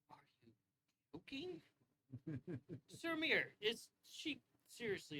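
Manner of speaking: high, astonished squeak of disbelief; incredulous. Acted. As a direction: pitch shoots way up in disbelief. Ithos stunned by Kippa's plan.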